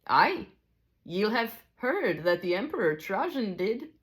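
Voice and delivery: high Scottish character voice; Scottish teacher, high and lilting; attempted Scottish accent. A narrator's reading. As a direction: prompt them in amateur Scots, pitched high. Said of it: "I'm not good at a Scottish accent".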